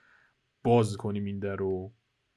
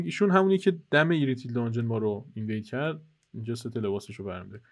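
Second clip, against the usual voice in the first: usual speaking voice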